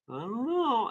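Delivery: a teasing high evasion; mock whiny dodge. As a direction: play it off coyly. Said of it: "I'm like".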